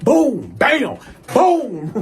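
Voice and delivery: high, punchy hit sounds; emphatic "boom, bam" sound effects; imitated blows. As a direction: act out each punch with the voice. Imitating the beating.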